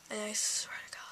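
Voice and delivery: breathy unvoiced under-the-breath vow; whispered oath. Real, not acted. A teen whispering a vow to the camera about her instructor.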